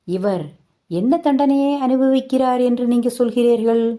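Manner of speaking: challenging retort. Retold or imitated. Mother challenges grandma.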